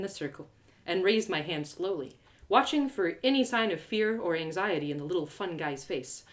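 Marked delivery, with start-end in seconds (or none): none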